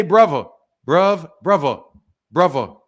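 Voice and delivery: "brother" the British way, with a V for the TH; British "bruvva". Shown as a demonstration. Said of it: "say it like a British dude".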